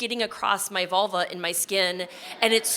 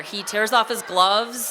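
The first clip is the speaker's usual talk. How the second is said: ordinary talk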